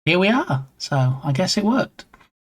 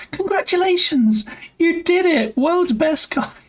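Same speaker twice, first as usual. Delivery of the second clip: Will Ferrell as Elf. Retold or imitated. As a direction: gush like an overjoyed elf, pitched way up. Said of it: "and he goes".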